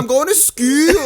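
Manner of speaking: put-on very high Scottish child's whine; Scottish or Irish accent. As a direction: imitate an indignant little girl with a Scottish accent. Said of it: "that sounds like a Scottish or".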